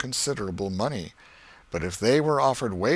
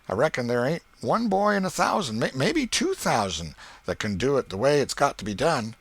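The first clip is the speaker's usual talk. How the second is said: put-on lightly raised, louder boyish voice, quick at the end; boy Tom, sly; 5 semitones higher, 5 dB louder than usual. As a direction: play it cool and sly like a scheming kid.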